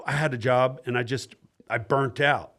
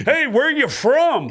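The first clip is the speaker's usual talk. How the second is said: high, slow comic quizzing the crowd; crowd work impression; 11 semitones higher, 5 dB louder, slower than usual. Comic asking a crowd member where he's from, high and slow. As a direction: lightly mimic Butch Bradley working the crowd. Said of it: "Oh, Butch Bradley in the crowd".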